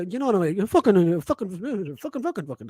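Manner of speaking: ordinary talk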